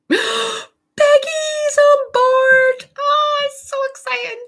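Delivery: gasp, then very high drawn-out squeal; thrilled squeal. Real, not acted. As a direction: squeal with real excitement. Thrilled a viewer has joined.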